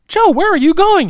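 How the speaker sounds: high, loud voice for the assistant; mock assistant. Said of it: "his assistant's like".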